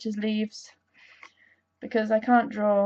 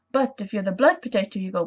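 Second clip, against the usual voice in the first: ordinary delivery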